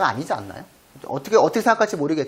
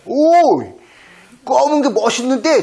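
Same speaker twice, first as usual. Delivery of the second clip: high, loud delighted exclamation; excited praise to a cat; 9 semitones higher, 6 dB louder, slower than usual. Retold or imitated. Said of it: "제가 그래요".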